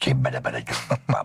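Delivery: quick, comic skipper gibberish; Kippari-Kalle voice. Retold or imitated. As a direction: rattle it off like a cartoon sailor. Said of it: "sillä äänellä".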